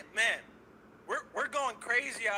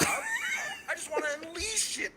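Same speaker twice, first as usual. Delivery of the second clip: high, slow angry yell; unleashed anger; 13 semitones higher, slower than usual. Real, not acted. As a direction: finally let the rage burst out. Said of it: "I feel genuine anger right now".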